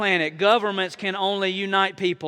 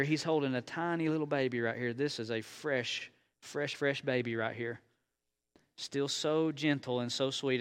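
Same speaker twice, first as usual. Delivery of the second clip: put-on much softer, tender voice over the baby; soft and gentle; 5 semitones lower, 9 dB quieter than usual. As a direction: hush down for the little one. Softly points out baby.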